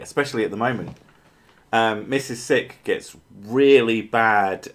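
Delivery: ordinary talk, nothing marked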